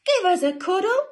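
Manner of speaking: loud, very high storybook cry; excited cry. Acted. Great big man chasing a cuddle, loud high cry. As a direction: call out for it eagerly. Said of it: "he cried".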